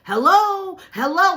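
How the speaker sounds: high, loud calling out over and over; retold yelling to snap her out of a daze; yelling drug dealer. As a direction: shout to get her attention. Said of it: "he's yelling at me".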